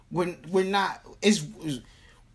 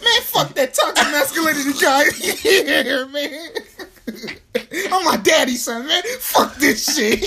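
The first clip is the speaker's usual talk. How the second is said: high, loud rant through laughter; joking outburst, laughing hard and pitched up; mock defiance; 7 semitones higher, 6 dB louder, slower than usual. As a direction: pretend to refuse self-improvement while cracking up. Mock rejection of growth, cracking up.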